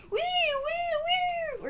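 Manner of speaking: very high squeaky wee-wee, in a strange voice; woozy anesthesia wee. Retold or imitated. Kid mimicking the rush of anesthesia, high squeaky singsong. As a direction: squeal it like time rushing by under anesthesia. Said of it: "It was going like".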